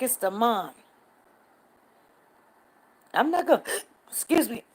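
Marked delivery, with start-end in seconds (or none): 3.1-4.6 talking through hiccups. Real, not acted. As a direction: hiccup breaks the words. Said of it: "I got the hiccups".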